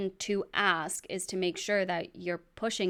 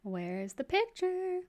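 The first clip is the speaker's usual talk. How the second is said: "Where's the picture?" stretched slow and very high; high, drawn-out mock plea; 12 semitones higher, much slower than usual. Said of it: "I was gonna say".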